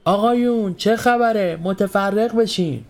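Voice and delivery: high, drawn-out officer's shout; shouting at the crowd. A narrator's reading. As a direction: call out to disperse a crowd. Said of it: "رو به مردم کرد و داد زد".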